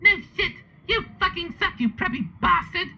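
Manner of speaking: high falsetto insult; hammed-up attack in falsetto; attacking character. A narrator's reading. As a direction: hurl it in a squeaky put-on voice. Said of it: "said Willow, trying to attack him".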